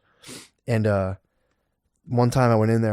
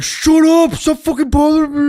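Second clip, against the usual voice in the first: much higher, louder hammed-up yell; mock yell; 5 dB louder, slower than usual. Dealer snapping at his wife, mock yell. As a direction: bark it out like a crazed kingpin. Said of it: "I'm like".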